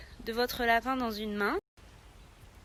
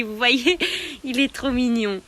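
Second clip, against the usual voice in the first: normal talking voice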